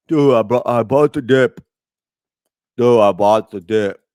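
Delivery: loud, cocky crypto bro. Pretend. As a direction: brag like a hyped crypto bro. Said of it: "Do I sound like a crypto bro?".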